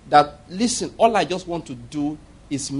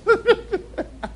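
a sudden high burst of laughter. Real, not acted. Laughing at his wife's reaction.

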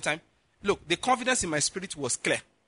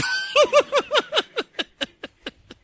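high burst of real laughter; big laugh; 4 dB louder than usual. Real, not acted. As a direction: laugh out loud at the punchline. Preacher laughs at own punchline.

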